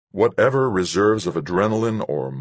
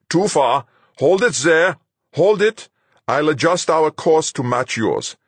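put-on high, loud, accented radio orders; Fuchs, urgent commander; 10 semitones higher, 6 dB louder than usual. A narrator's reading. Fuchs guiding the pilot in by radar, urgent commander. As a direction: give insistent flight directions.

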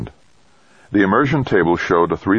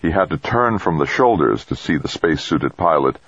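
usual speaking voice

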